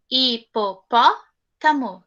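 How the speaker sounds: "Hipopótamo" slow, five separate beats; syllable by syllable. Shown as a demonstration. Teacher sounding out a long word for the class. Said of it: "cinco sílabas".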